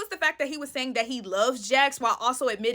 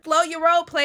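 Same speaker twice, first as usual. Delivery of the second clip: sassy scolding; 5 semitones higher, 7 dB louder than usual. Pretend. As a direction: put him in his place. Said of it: "he was like".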